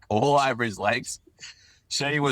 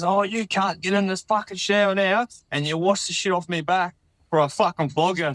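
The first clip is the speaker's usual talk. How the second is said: higher threatening mate voice; angry mate; 8 semitones higher than usual. Retold or imitated. Storyteller voices mate's threat, higher mock anger. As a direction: imitate the mate ordering him into the shower. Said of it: "he goes".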